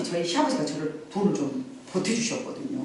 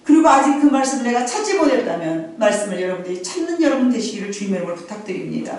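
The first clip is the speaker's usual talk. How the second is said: loud, raised preaching voice; full-force preaching; 5 semitones higher, 5 dB louder than usual. Acted. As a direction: proclaim it to the congregation. Preaching to the congregation. (힘찬 설교 톤)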